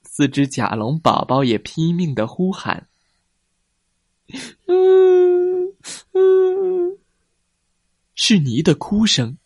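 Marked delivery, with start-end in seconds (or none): 4.7-7.0 high whimpering cry; baby dinosaur crying. Acted. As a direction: sob like a small child.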